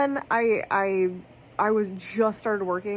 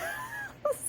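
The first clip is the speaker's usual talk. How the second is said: high squeaky giggle; a high little squeak of a laugh; 10 dB quieter than usual. Real, not acted. Laughs at own congestion.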